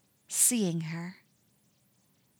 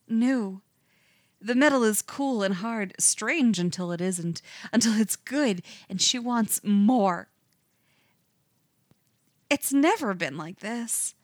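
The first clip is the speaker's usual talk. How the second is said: usual speaking voice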